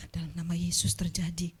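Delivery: hushed, breathy murmured prayer for healing; preacher mimics a whispered prayer for healing. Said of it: "Paling saya doakan".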